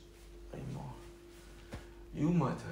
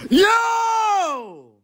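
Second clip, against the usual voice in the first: screaming yo; 10 dB louder than usual. Acted. Loud greeting opening mock ASMR video.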